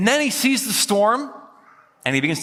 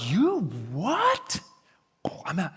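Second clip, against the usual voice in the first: stunned reaction; 4 dB quieter, slower than usual. Acted. Disciple stunned by rabbi's call.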